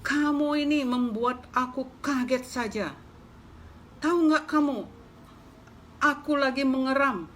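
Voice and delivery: high, loud scolding hen; angry hen scolding. Acted. Hen scolding the mouse for startling her, loud and high. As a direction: rant at the mouse like an outraged mother hen. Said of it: "kata si ayam marah-marah".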